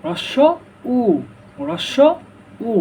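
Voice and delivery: "রস্ব উ" in two separate parts; correct pronunciation. Shown as a demonstration. Teacher introduces the next vowel, carefully separated "রস্ব উ". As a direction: say "রস্ব", pause, then a clear "উ". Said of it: "সঠিক উচ্চারণ কি".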